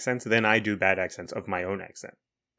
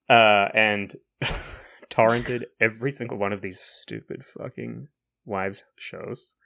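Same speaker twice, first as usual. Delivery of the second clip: ordinary talk, nothing marked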